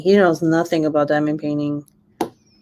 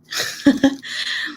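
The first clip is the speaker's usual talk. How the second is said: high giggle after the impression; laugh; 8 semitones higher than usual. Real, not acted. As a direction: giggle at your own mockery.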